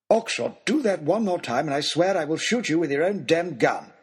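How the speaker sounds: high, seething lordly outburst; outraged aristocrat's warning; exasperated threat. Acted. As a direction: threaten him at the end of your patience. Lord threatens the baronet.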